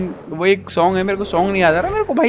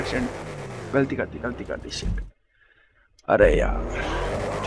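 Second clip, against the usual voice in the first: much lower and softer, deflated; deflated groan; 10 semitones lower, 10 dB quieter, slower than usual. Real, not acted. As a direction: sink into disappointment. Mistake, deflated muttering.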